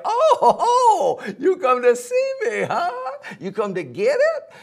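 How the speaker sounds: high voice bubbling with laughter; cocky dog laughing at the gator. Acted. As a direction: chuckle through the words, way up high.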